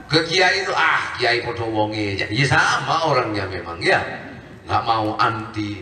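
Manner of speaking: lightly voiced scornful layman; dismissive mock voice. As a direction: mimic the scoffer lightly, brushing it off. Mocking people who shun teachers.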